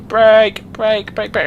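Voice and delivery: high, loud repeated cry; frantic shouted command; urgent shout. Real, not acted. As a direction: yell at the car to stop. Shouting at car to stop.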